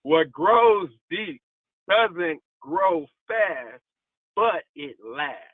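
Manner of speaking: slow it right down so it sinks in; slow emphatic repeat. Shown as a demonstration. Preacher repeating the line slowly to the congregation, drawn out. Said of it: "Let me say that one more time".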